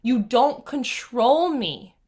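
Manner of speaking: slow, firm command to her own mind; reenacting shutting down her inner critic, a touch stern; telling off inner voices. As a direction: draw a line against the voices, slowly. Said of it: "I had to be like".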